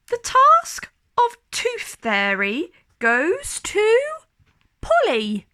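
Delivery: slow, loud, high fanfare of a Fairy Godmother; proud Fairy Godmother naming the prize; grand announcement. A narrator's reading. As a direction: announce the winner with ceremony.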